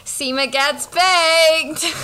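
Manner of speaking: slow, drawn-out excited shout; excited reveal. Real, not acted. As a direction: announce it like big news.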